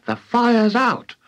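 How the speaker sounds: put-on high, slow boyish declaration; proud announcement. A narrator's reading. George after dousing grandma, announcing proudly. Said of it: "George announced proudly".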